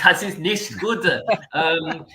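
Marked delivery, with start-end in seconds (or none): 0.0-1.0 mock German accent. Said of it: "Your German is poor".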